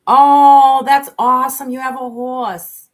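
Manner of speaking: very loud, high oh; delighted. Real, not acted. Hearing a viewer has a horse, loud delighted gush. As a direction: gush over the news.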